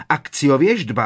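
shouted spell. A narrator's reading. Bellatrix summoning the prophecy.

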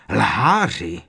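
loud screech of rage. A narrator's reading. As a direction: scream the accusation. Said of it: "zavřeštěla". (zavřeštění)

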